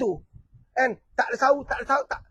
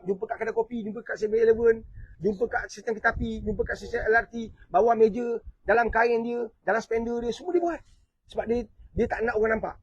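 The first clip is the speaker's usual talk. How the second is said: ordinary delivery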